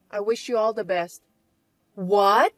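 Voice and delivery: ordinary talk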